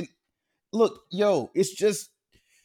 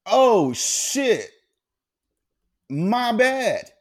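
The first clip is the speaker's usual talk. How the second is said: sheepish realization; 5 semitones higher, 4 dB louder, much slower than usual. Retold or imitated. Retold realization of his mistake.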